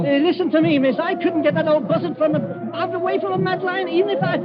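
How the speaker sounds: words tumbling out in rising panic; panicked pleading. Acted. Cornered plotter panics.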